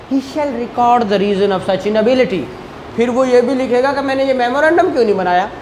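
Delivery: usual speaking voice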